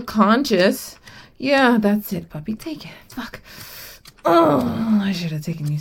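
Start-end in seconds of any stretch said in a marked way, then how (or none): 2.5-3.4 breathy whispered command. Acted. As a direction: whisper it close. Whispered aside in roleplay.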